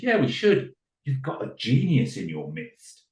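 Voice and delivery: high, emphatic agreement; enthusiastic praise. Acted. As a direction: eagerly talk up a child's genius. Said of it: "I was praising Dylan".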